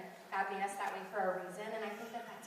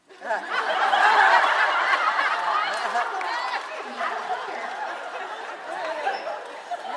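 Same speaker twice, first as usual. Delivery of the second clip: loud, high teary laugh bursting out; big tearful laugh; 13 semitones higher, 17 dB louder than usual. Real, not acted. Speaker laughing off her tears before the crowd, high loud laugh.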